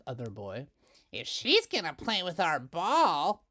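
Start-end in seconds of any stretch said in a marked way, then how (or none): 1.1-3.5 a lightly voiced bratty girl, higher and louder; a mean kid setting terms; higher, louder schoolyard brat; Doris. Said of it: "said Doris".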